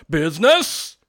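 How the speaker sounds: loud, indignant cry. Acted. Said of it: "cried the ghost".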